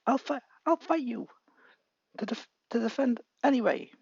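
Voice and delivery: put-on high, stammering kid voice; nervous brave boy. Deku tells Bakugo he'll fight him anyway, high nervous voice. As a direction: force out a shaky challenge.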